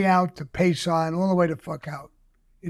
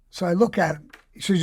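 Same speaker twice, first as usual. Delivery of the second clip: ordinary talk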